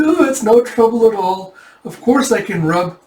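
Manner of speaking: high, eager-to-please voice; over-eager agreeing. Retold or imitated. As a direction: agree too readily, pitched high. Character hastily agrees to rub shoulders.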